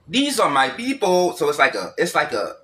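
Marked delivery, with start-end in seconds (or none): 0.1-1.4 put-on slow, raised, up-and-down African accent. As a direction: imitate an African accent with a swinging pitch. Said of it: "it's like a up and down pitch".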